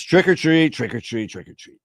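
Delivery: put-on kids' chant tailing away; trick-or-treaters calling. Joke voices kids calling at door.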